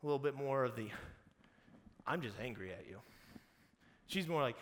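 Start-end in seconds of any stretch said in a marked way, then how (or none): 2.1-3.0 low, blunt imitation; point blank. Mary gets up to Jesus, point blank. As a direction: state the grievance point blank, without raising the voice. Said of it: "point blank".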